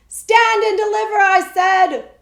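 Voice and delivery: loud, high demand to stop; highwayman's shout. A narrator's reading. Highwayman halts the coach and demands loot, shouting. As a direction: bellow it at the coach.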